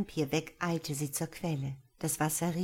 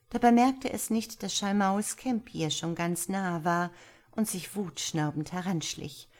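usual speaking voice

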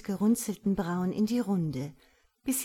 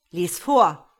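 much louder curt command; shouted order; 12 dB louder than usual. A narrator's reading. God orders Petra to read, shouting. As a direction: order it loudly, no patience. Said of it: "schreit Gott".

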